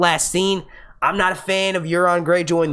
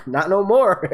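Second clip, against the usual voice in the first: laughing; 3 semitones higher, slower than usual. Real, not acted. Laughing about dead character.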